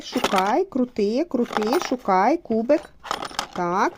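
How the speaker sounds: ordinary talk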